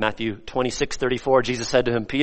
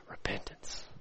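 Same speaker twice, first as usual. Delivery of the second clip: hushed whisper for effect; 17 dB quieter than usual. Real, not acted. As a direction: let the word drop to a whisper. Whispers key word after loud preaching.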